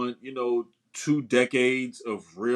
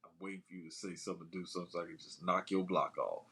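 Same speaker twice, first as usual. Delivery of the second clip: low, very soft imagined threat; quiet, unbothered imitation of a waiting fighter; 6 semitones lower, 12 dB quieter, faster than usual. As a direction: murmur it coolly, as if nothing matters. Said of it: "like, unbothered".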